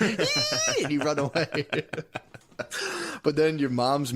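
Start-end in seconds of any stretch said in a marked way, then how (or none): 0.0-0.8 very high panicked squeal; a short shrill squeak of fright; mock squeal. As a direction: squeal and run. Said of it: "and you're like".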